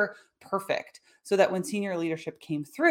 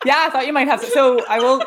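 laughing; 9 semitones higher, 10 dB louder than usual. Real, not acted. Host laughs along with guest.

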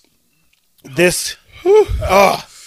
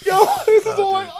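laughing while talking; 14 semitones higher than usual. Real, not acted. Laughing through pain.

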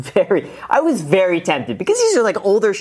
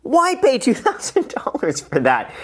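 incredulous; 8 semitones higher, much slower than usual. Real, not acted. Reacting to paying for feet videos.

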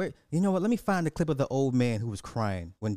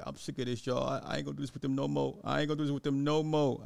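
imitating crying old man; faster than usual. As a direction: mumble it fast like a weepy fan. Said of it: "he was like".

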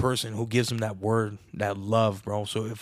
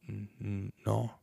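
soft, stammering mock refusal, much quieter; a frightened clerk stuttering no; scared stammer; 8 dB quieter, slower than usual. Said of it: "he's looking at me scared".

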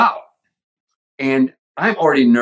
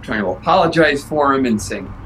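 ordinary talk, nothing marked

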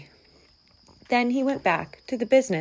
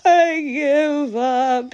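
high, slow, wailing surrender; mock-sobbing defeated bear; blubbering; 7 semitones higher, 4 dB louder, much slower than usual. A narrator's reading. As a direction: blubber it out, drawing each word. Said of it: "he blubbered".

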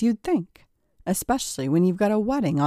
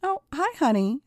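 high, innocent greeting; sudden high-pitched, casual hello; covering up; 8 semitones higher than usual. A narrator's reading. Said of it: "said Paul, quickly changing the subject".

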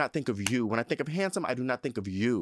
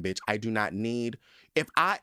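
usual speaking voice

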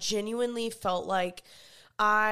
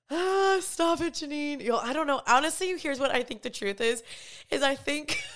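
fighting back tears; tearful; 6 semitones higher than usual. Real, not acted. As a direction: voice breaking, high and teary. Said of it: "why am I getting emotional?".

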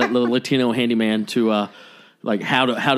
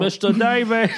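put-on Curb quote accent; 10 semitones higher than usual. Quoting the Curb handyman to cohost, light accent. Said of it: "Inside joke".

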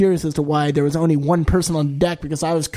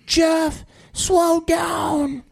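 high-pitched calling out; mock alarm, pitched way up; retold urgent shout; 13 semitones higher, much slower than usual. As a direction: yell it high to the driver, acting it out. Retold urgent call to the driver.

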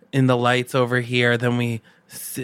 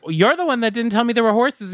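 high, fast indignant retort; hammed-up squabbling protest; mock accusation; 10 semitones higher, faster than usual. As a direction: throw the blame back, playfully. Acted playful quarrel.

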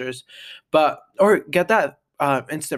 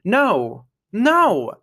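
loud no; 6 semitones higher, 4 dB louder than usual. Retold or imitated. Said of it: "I'm like".

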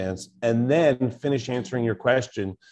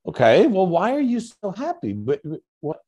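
high, louder puzzled mother; puzzled mom; 8 semitones higher, 4 dB louder than usual. Retold or imitated. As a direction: mom guessing excitedly. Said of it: "so she says".

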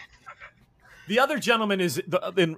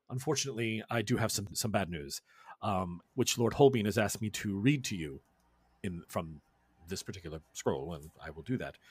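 much quieter, somber; grave messenger; 9 semitones lower, 10 dB quieter than usual. Acted. The lord's messenger warns the crew of bad news, quietly.

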